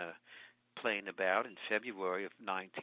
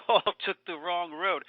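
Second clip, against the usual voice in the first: amused, higher echo; laughing; 5 semitones higher, 5 dB louder than usual. Real, not acted. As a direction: repeat it through a laugh, louder. Repeats it, laughing.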